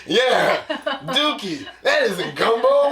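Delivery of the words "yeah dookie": loud laughing blurt of Dookie; laughing punchline. Real, not acted. Host laughing as he delivers the gumbo punchline. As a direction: burst out the word through laughter.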